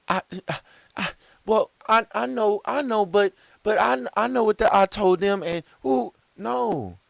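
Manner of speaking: high, stammering excuse-maker, lightly hammed up; flustered protester. Adult stammering excuses when called out, mock flustered.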